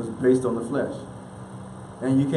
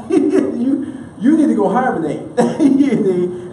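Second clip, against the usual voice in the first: higher, louder, broken by laughter; laughing retelling; 6 semitones higher, 4 dB louder, slower than usual. Retold or imitated. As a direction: try to say it while cracking up. Amused retelling of bear comparison.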